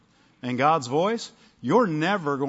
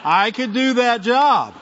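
amused joking; 7 semitones higher, 3 dB louder than usual. Real, not acted. Joking he'd like the job.

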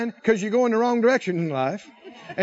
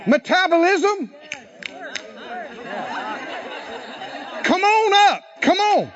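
raised-voice preacher's command; commanding the body; 4 semitones higher than usual. Shown as a demonstration. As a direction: order it up with force. Commanding own metabolism to rise.

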